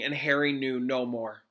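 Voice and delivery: plain reading voice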